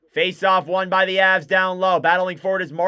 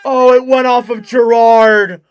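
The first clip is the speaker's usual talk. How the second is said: loud, higher, stunned realization; astonished outcry; 4 semitones higher, 11 dB louder, slower than usual. Real, not acted. Commentator seeing it went off Girard, astonished outcry. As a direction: cry out in disbelief, drawing it out. Sees replay of the goal.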